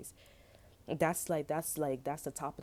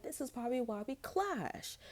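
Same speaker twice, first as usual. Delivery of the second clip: higher sassy verdict; 8 semitones higher than usual. Retold or imitated. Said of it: "And I'm like".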